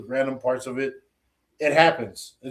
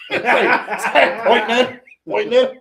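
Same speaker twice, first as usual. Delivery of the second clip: louder, high, wheezing with laughter; cracking up mid-sentence; laughing; 6 semitones higher, 7 dB louder than usual. Real, not acted. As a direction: barely get it out through laughs. Laughing retelling movie gag.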